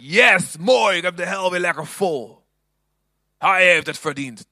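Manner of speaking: loud smug triumph; a false God relishing punishment, mock glee; mock gloating. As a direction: cheer at a full hell, hammed up. Wrong image of God voiced.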